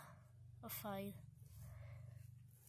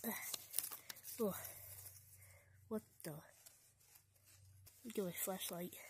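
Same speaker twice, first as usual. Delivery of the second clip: whispering; 14 dB quieter, faster than usual. Acted. Sneaking in a dark cave.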